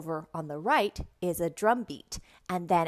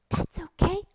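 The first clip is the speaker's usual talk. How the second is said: high, encouraging teacher voice; perky, high-pitched comfort; upbeat reassurance; 12 semitones higher, 4 dB louder than usual. Shown as a demonstration. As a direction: cheerfully let a child know it is fine. Reassures imagined child.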